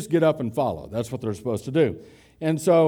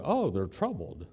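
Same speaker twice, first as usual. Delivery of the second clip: mock pity; 4 semitones lower, 6 dB quieter than usual. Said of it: "when you think of someone who's troubled".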